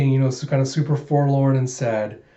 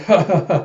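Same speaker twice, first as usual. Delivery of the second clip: forced awkward ha ha ha, louder; fake laugh; 4 semitones higher, 4 dB louder than usual. Embarrassed Figure covers his flop, forced laugh.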